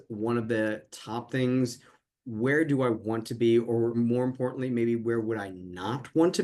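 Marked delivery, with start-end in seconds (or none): none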